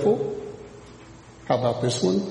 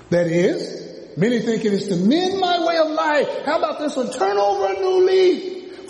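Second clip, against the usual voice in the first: preaching at full force. Acted.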